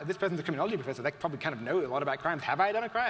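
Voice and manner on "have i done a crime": higher, louder mock-alarmed Have I done a crime?; exaggerated disbelief. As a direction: push the question up in mock alarm. Repeats own question for emphasis.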